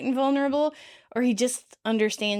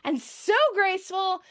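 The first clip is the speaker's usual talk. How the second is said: high, gushing delight; acted exhilaration; 9 semitones higher than usual. A narrator's reading. Rachel running backwards beside Phoebe, exhilarated. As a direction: gush freely, pitched well up.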